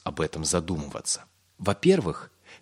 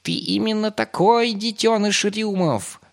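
high, loud, drawn-out taunting; growling rat; 6 dB louder, slower than usual. A narrator's reading. Rat springs from behind a tree to accuse Pip, growling. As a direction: jeer slowly at full pitch. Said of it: "прорычала Фучука".

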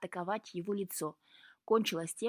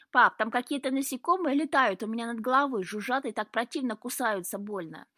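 put-on loud, higher child's complaint; displeased cries; 4 semitones higher, 6 dB louder than usual. A narrator's reading. Boy running to his father about bugs, displeased. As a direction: whine to dad, louder and higher. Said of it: "раздались недовольные вскрики Луки".

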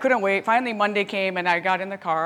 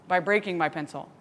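ordinary delivery

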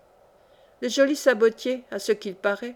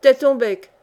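louder, snapping shut-up; sharp retort; 3 semitones higher, 7 dB louder than usual. A narrator's reading. Yomi silencing the mocking voyageur, sharp retort. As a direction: slap the heckler down. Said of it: "répliqua le joli sabotier".

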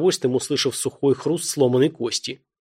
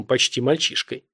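ordinary delivery

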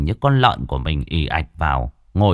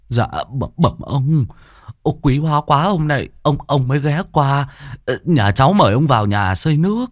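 put-on obsequious old man; 5 semitones higher than usual. Old man greeting rich lender.

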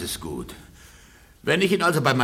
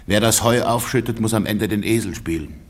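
ordinary talk, nothing marked

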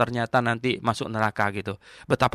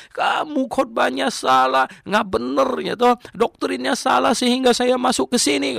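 angry accusation; 10 semitones higher, 3 dB louder than usual. Retold or imitated. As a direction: berate the preacher as the angrier man in the story. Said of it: "dia lebih marah lagi".

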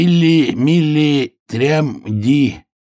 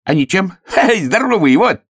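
high, laughing boast; merry high-pitched hare; cheery hare; 13 semitones higher, 3 dB louder than usual. A narrator's reading.

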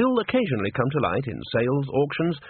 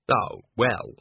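dismissive. A narrator's reading. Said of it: "Andre began dismissively".

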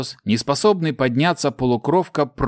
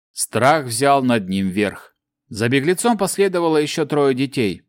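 usual speaking voice

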